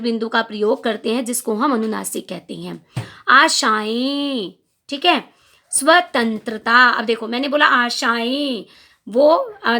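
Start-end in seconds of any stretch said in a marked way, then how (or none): 3.3-4.5 आशाएं drawn out very slowly, final vowel through nose and mouth; nasalized vowel. Shown as a demonstration. Teacher showing students the nasal ending of आशाएं. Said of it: "नाक से और मुंह से दोनों से आवाज़ निकल रही है". 5.7-6.9 स्वतंत्रता spoken slowly, the न sound hummed through the nose; nasal consonant. Shown as a demonstration. Teacher demonstrating the anusvara sound in स्वतंत्रता. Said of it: "सिर्फ नाक से आवाज़ निकल रही".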